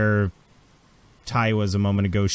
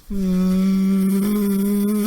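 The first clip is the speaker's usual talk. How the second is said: long, high engine whine; shuttle noise; 8 semitones higher, 4 dB louder than usual. Acted. Game master imitates a shuttle racing for the manor, long engine whine. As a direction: hum a sustained, raised spaceship drone. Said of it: "there is a shuttle going".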